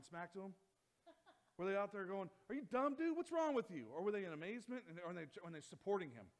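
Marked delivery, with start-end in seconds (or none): 2.5-3.9 high, loud taunting heckle; mocking jeer. Disciples jeering at Peter from the boat, loud mocking heckle. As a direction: jeer at him from the boat like a scoffing friend.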